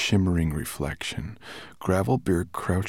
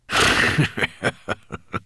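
dragon's hoarse chuckle; rasping laugh to oneself; hoarse private laugh; 4 dB louder than usual. A narrator's reading. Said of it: "laughing hoarsely to himself".

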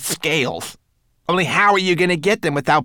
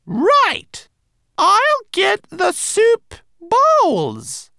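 high, very slow, pointed announcement; announcing; 3 dB louder, much slower than usual. A narrator's reading. Rat covering her ambush of a lurker, announcing pointedly. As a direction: announce it with exaggerated deliberateness. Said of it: "announced the rat".

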